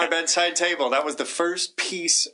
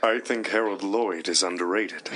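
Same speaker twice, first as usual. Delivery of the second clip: much lower, ponderous Tatum voice; deep Tatum impression; 8 semitones lower than usual. Shown as a demonstration. [name] repeats the line as Tatum for the panel. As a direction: sink the pitch way down like Tatum. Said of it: "repeat the line in our deep take".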